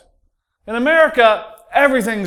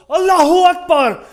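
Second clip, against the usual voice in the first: high, loud triumphant shout; fan's yell; 9 semitones higher, 5 dB louder than usual. Retold or imitated. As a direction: yell it like a football fan celebrating a goal. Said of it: "stands up in the back and yells".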